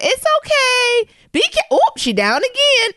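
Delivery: very high, loud, slow mock-coaxing auntie; hammed-up high auntie cheering her on; aunt's voice. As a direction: coo it like a fussing aunt. Aunt voiced watching her fall.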